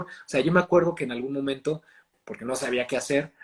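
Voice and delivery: ordinary talk, nothing marked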